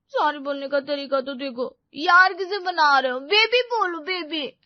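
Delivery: high, loud, petulant scolding; sulky high-pitched correction; indignant girlfriend. Acted. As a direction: demand the pet name, offended. Voices Payal scolding the apology.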